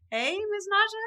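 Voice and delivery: high, loud laughing hello; real laughing welcome; laughing greeting. Real, not acted. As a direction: greet her through a laugh. Host greets guest, laughing.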